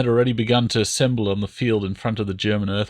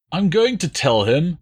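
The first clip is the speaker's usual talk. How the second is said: whined; 7 semitones higher, 3 dB louder than usual. A narrator's reading. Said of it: "the man whined".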